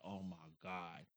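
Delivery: low, very soft, slow aside; exasperated dread. Retold or imitated. Wife during husband's awkward pause, quietly exasperated. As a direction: sigh it low and slow under your breath.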